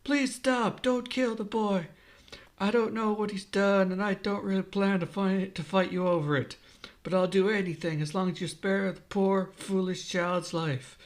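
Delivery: high-pitched begging; acted entreaty, pitched well up; desperate pleading. A narrator's reading. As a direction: plead for a child's life, starting very high. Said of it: "Slap pleads".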